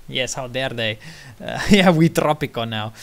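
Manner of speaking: ordinary talk, nothing marked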